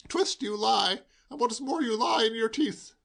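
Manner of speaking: high, theatrical accusing; mock accusation. A narrator's reading. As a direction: denounce him like a stage villain.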